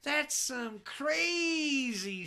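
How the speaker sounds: hammed-up dealer hailing a rocker; stoned dealer. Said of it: "he goes".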